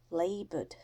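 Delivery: plain reading voice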